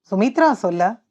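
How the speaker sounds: ordinary delivery, nothing marked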